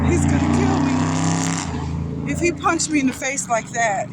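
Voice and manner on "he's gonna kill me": hushed, slow, frightened whisper, pitched up; whispered terror, drawn out slowly. Retold or imitated. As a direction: breathe it out as if he might hear. Terrified thought, fearing father.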